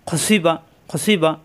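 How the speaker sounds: খসিবা খসিবা read slowly, syllables evenly drawn out; slow demo reading. Shown as a demonstration. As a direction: read খসিবা twice, slowly, for learners to copy. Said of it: "আরেকবার পড়ি".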